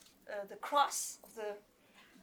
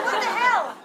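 high, loud what the hell; loud disbelief; 9 semitones higher, 12 dB louder than usual. Retold or imitated. Recalling the paper mix-up, a high loud outburst. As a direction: throw it out in disbelief. Her confusion retold.

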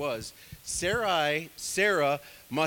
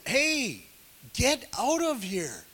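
higher, half-shouted Pharaoh sending him off; mock yelling Pharaoh; 8 semitones higher, 3 dB louder, slower than usual. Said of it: "I bet there was yelling back then".

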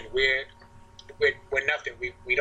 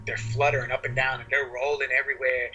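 normal talking voice

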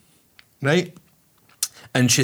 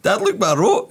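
put-on higher, eager little girl repeating herself; proud child; 9 semitones higher than usual. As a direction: sing it out like a pleased kid. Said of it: "she goes".

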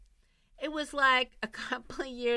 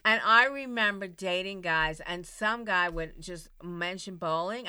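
ordinary talk, nothing marked

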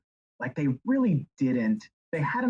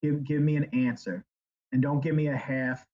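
ordinary talk

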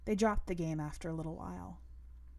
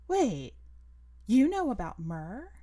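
louder, sudden surprised question; startled question; 3 semitones higher, 6 dB louder, slower than usual. Acted. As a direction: blurt it out, caught off guard. Girl startled at the wheel.

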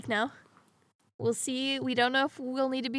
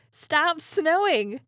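mock pleading; 2 semitones higher, 6 dB louder, slower than usual. Begs the weather to stop.

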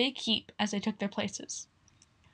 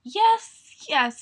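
wearily; 5 semitones higher, 5 dB louder than usual. Acted. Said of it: "he said wearily".

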